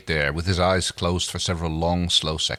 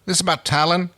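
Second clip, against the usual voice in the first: Crichton's question; 11 semitones higher, 4 dB louder than usual. Acted.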